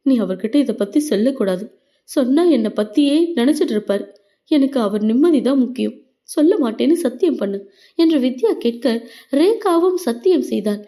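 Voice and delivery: plain reading voice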